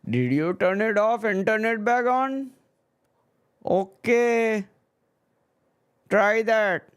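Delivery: high, exaggerated Indian accent for the cable guy. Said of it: "I can do that accent".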